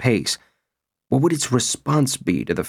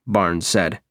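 ordinary delivery, nothing marked

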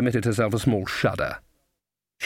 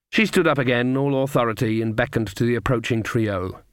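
plain reading voice

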